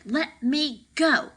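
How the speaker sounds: high, slow, straining cry; struggling cry. Acted. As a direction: plead while fighting to get loose. Boy struggling against guard.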